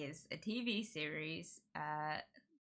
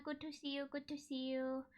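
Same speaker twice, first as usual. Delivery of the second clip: soft cooing; 7 semitones higher, 8 dB quieter than usual. Real, not acted. Cooing to pet.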